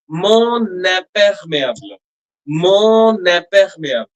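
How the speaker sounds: Mon imperméable with the final N linked into the vowel; liaison on mon. Shown as a demonstration. Teacher shows the liaison, linking the N. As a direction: carry the N of Mon straight into imperméable. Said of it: "So we pronounce like this".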